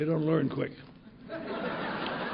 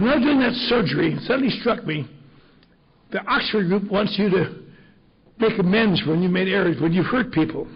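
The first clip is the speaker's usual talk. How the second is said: loud, higher-pitched, eager retelling; excited explanation; 5 semitones higher, 8 dB louder than usual. Retold or imitated. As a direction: act the doctor exclaiming his sudden realization. Retold doctor's excited explanation.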